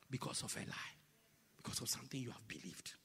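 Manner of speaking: soft, low, breathy drop; sudden whisper. Acted. Preacher suddenly whispering to the church.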